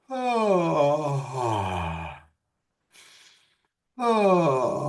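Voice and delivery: sighing ah like a yawn. Shown as a demonstration. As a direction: let it out like a big yawn. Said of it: "You can do a yawn sigh".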